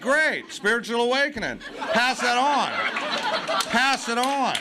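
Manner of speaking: mock-enthusiastic marching orders; mock pushy sponsor. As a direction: push it at the newcomer like an order. Sponsors acted, pushing him on.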